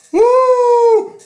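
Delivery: high loud storybook scream; long, very high hammed-up wail; frightened shriek. A narrator's reading. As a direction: shriek "woo" in mock terror. Schoolmaster shrieks at the lion.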